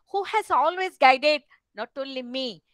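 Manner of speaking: normal talking voice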